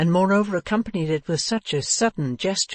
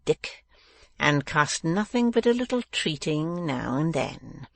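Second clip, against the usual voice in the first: slow, drawn-out villain's musing; sly dwarf thinking aloud; scheming villain; slower than usual. A narrator's reading. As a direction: savour each phrase slowly, plotting.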